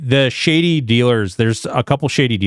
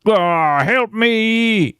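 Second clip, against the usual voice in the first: high desperate plea; desperate cry; 9 semitones higher, much slower than usual. Pretend. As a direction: cry for help while drowning. Voices drowning character.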